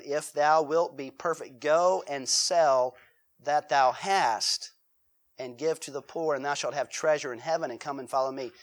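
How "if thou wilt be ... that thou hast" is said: ordinary talk, nothing marked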